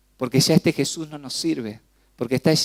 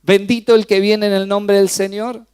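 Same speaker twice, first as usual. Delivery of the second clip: raised, louder crowd acclaim; crowd shouting; 6 semitones higher, 5 dB louder than usual. Retold or imitated. The preacher voices the crowd acclaiming Jesus, raised and loud. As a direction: call it out like the cheering crowd. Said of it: "se desgargantaron gritando".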